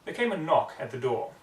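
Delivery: usual speaking voice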